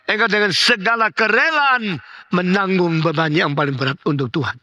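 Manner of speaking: high, ringing preaching, pushing on; full-force preaching. Acted. Preacher urges congregation to be filled, fervent, high. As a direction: exhort them fervently toward joy.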